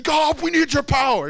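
high, loud, mock pleading plea; mock pleading prayer. Preacher mimicking the congregation's loud, high plea to God. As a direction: cry it out high like a needy prayer. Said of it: "instead of just saying".

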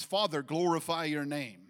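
loud public prayer, quoted; praying out loud. Retold or imitated. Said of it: "he's praying out loud publicly".

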